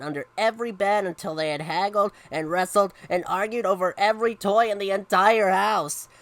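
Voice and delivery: lively picture-book reading; story narration. A narrator's reading. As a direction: read it to children with punched words. Reads a story aloud.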